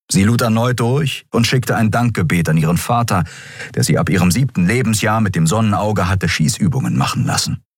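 ordinary delivery

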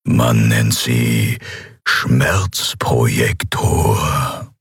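very slow, low menacing voice; unperturbed emperor. A narrator's reading. Emperor explains the torture device to his captive, unperturbed. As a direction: explain it coldly, stretching each word. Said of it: "erklärte der Kaiser Endriel unbeirrt".

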